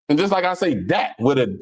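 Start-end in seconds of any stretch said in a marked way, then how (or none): 0.9-1.2 "that" said as DAT, hard D at the start. Shown as a demonstration. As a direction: swap the TH in "that" for a D. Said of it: "with a, with a D".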